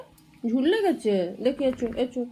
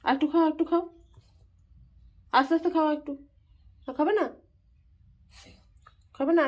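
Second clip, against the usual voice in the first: higher, sing-song coaxing to drink water; coaxing pet talk; 4 semitones higher than usual. Real, not acted. Owner coaxing pet to drink water, higher sing-song coaxing. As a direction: gently urge the little one to drink.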